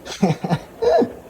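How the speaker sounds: real high-pitched laugh; a quick amused giggle. Real, not acted. Host laughs at himself, high giggle. Laughing at his own joke.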